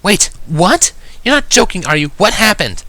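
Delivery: high-pitched, loud shocked questions; shocked, troubled high voice; shocked and disturbed. A narrator's reading. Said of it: "sounding both shocked and disturbed".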